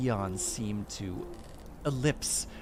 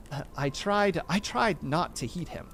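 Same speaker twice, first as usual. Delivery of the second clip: plain reading voice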